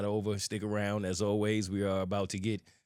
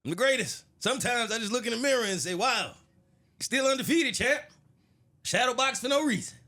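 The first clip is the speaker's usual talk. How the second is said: Muhammad Ali impression; 6 semitones higher than usual. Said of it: "uh, Muhammad Ali?".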